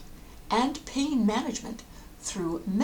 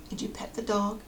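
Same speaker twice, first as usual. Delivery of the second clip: ordinary talk, nothing marked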